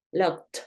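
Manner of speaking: "Locked" said "lokt"; strong final T. Shown as a demonstration. Teacher shows the stronger sound after K, strong final T. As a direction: finish "Locked" on a crisp T. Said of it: "Esa T".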